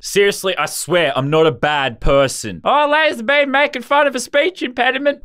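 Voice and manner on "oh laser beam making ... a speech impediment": high-pitched put-on whine of a critic; high, mocking caricature of a scold; mock complaining commenter. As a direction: mimic an offended viewer, pitched way up. Mimics a complaining viewer again.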